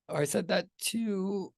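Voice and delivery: ordinary talk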